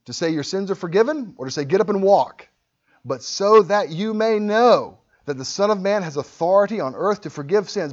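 Jesus pressing the scribes; challenging Jesus. Retold or imitated. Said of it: "He said".

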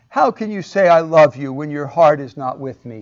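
mock whine at her husband; whiny Delilah. Delilah whining to Samson about his secret. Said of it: "she whines to him".

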